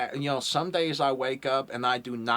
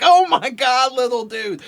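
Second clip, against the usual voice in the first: high, much louder stunned cry; shocked disbelief; 13 semitones higher, 12 dB louder than usual. Retold or imitated. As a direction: react in amazement at a cheeky eleven-year-old. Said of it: "I'm like".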